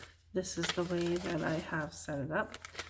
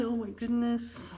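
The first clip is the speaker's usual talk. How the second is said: yawning; 4 semitones higher than usual. Real, not acted. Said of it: "Excuse my yawn".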